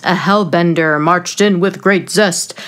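usual speaking voice

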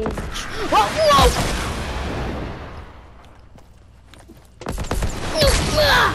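high panicked yelps; panicking gamer. Real, not acted. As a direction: cry out in sudden panic. Panic in game.

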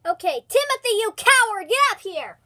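high, loud teasing call. Real, not acted. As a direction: yell for him to come back. Calling brother back.

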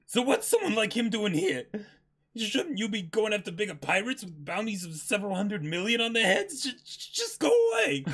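high, loud, panicky pleading, cracking into a yelp at the end; a coward begging the admiral to leave; scared Usopp. Acted. Usopp begs the admiral to leave, high and panicky.

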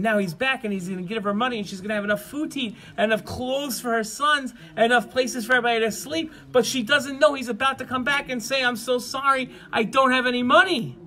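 rising high and loud toward the bad news; storyteller's escalating agitation; mounting suspense. A narrator's reading. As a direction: build breathlessly to a dreaded reveal. Suspense before letting her down.

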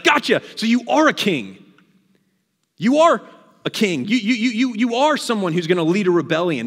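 loud, high-pitched accusing pounce; triumphant gotcha. Acted. Pilate catching Jesus claiming kingship, triumphant gotcha. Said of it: "This is a gotcha moment for Pilate".

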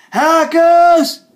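high, loud outraged cry; angry yell. Acted. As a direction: yell out at the culprits. Raging at hackers.

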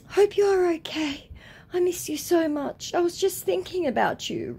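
high, put-on sweet-talk voice; mock a lovey-dovey texter in a high voice; gushing love-bomber. Scammer love-bombing the woman, gushing high.

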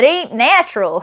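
mock country preacher warning, lightly done; hillbilly voice. Hillbilly scolding the listener, lightly voiced hillbilly accent. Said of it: "this hillbilly telling you".

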